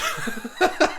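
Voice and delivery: high, breathy burst of laughter; breathless laugh. Real, not acted. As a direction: laugh it off, short of air. Laughing at his own breathlessness.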